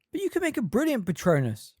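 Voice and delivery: put-on high, protesting young man; protesting Ron. A narrator's reading. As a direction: object to it, voice up high. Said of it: "protested Ron".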